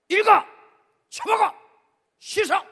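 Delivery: high, loud barked commands; imitating a drill-sergeant father. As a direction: bark each order like a Marine father. 해병대 아버지 명령.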